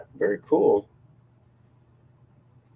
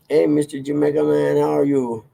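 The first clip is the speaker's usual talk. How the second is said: bad Jamaican accent; 5 semitones higher, 3 dB louder than usual. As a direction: ham up a clumsy Jamaican accent. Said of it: "That's a horrible Jamaican accent".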